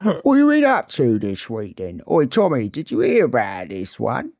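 hammed-up TV gangster voice; Peaky Blinders character impression. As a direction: needle them like a swaggering gang boss. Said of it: "I've been doing impressions".

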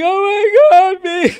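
imitated wailing wife, very high and loud; mock hysterical sobbing; hysterical crying. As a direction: squeal it like a wife bawling down the phone. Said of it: "she was crying hysterically".

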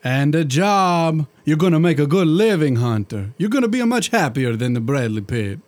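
put-on thick comic Italian genie accent; a jolly Italian genie, hammed-up accent. Genie chatting to his master in a hammed-up Italian accent.

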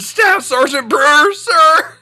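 high, loud recruit bark; barked military recruit reply. Retold or imitated. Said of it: "I said".

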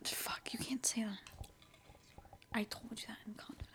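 hushed whispered mock scolding; secretive whisper. Scolding co-host for spilling a secret, whispered. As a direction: hiss it under your breath, barely audible.

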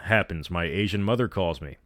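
ordinary delivery, nothing marked

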